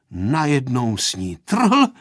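ordinary delivery, nothing marked

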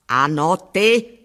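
slow, emphatic mild roar; gruff, drawn-out command; roared order. A narrator's reading. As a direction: bellow it, word by word. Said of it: "zařval".

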